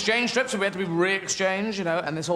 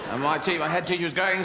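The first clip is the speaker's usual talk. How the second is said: ordinary talk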